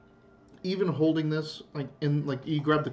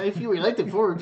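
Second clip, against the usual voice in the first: higher, accidental Mexican accent; slipped Mexican accent; 5 semitones higher, 4 dB louder, faster than usual. Real, not acted. Game master losing the dwarf's accent, slipping into Mexican. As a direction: drift unintentionally into a Mexican lilt. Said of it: "like, a Mexican accent".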